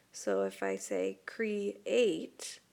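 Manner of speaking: "create" drawn out slowly as cre-ate, two clear vowels; teacher says "create" slowly to show the E and A split; sounding it out. Shown as a demonstration. Teacher to students showing the vowel-vowel split, slow "create". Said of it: "it would be separated between the E and the A".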